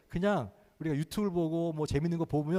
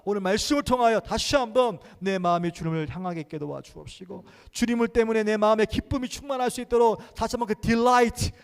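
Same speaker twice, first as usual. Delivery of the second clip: raised, driving prayer voice; fervent loud prayer; 5 semitones higher, 6 dB louder than usual. Acted. Pastor leading the church in loud prayer, fervent. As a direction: pray aloud with full fervor.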